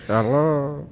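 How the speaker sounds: put-on high, loud, Nigerian-accented pastor greeting; hammed-up Nigerian preacher; old Nigerian pastor. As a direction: greet the flock with a big accented hello. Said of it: "this is how my old pastor used to talk".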